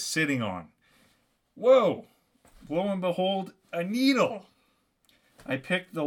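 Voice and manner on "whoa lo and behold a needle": high exclamation of surprise; hammed-up storyteller's amazement; delighted discovery. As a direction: burst out as if just finding it. Acted discovery of a means of escape.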